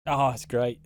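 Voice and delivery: ordinary delivery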